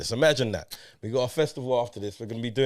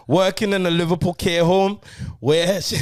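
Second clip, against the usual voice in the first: higher, louder dodgy Scouse; attempted Scouse accent; 5 semitones higher, 5 dB louder than usual. Host describing a care home job in the synopsis, attempted Scouse accent. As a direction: push on in a badly faked Scouse accent. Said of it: "That's a Scouse accent".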